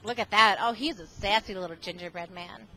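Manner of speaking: usual speaking voice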